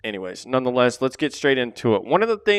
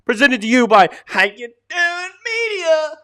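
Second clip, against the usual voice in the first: very high, loud show-host read; announcer intro; 12 dB louder than usual. Acted. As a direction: sell the podcast like a hype announcer.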